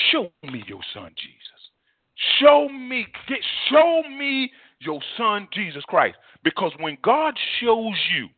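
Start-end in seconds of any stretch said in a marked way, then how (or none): 0.1-1.7 low, soft, humble plea; hushed prayer. Shown as a demonstration. Preacher modelling a prayer to the Father, hushed. 2.4-6.2 slow, high, insistent plea; fervent prayer. Shown as a demonstration. Preacher modelling a prayer to the Father, fervently pleading.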